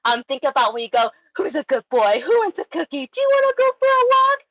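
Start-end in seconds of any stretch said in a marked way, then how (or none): 1.3-4.4 put-on excited dog baby talk building to a much higher, louder squeal. Shown as a demonstration. Trainer shows owners how to baby talk a dog, rising to high squeal. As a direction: start chatty, then soar up high and loud for the dog. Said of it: "this is the voice".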